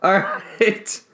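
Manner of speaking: usual speaking voice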